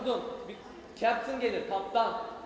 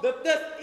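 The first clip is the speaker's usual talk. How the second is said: kapı sesi taklidi; 4 semitones higher, 6 dB louder than usual. Acted. Otobüs kapısı sesi.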